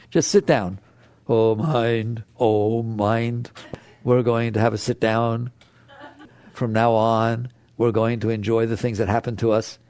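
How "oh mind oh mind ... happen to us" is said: lower, steady, patient words to one's own mind; calm firm talk. Shown as a demonstration. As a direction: settle the mind down like a calm parent. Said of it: "Use your mind consciously and say".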